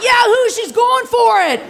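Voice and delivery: hammed-up party yell; cheering whoop. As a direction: cheer her on like a rowdy crowd. Friends cheering her onto floor.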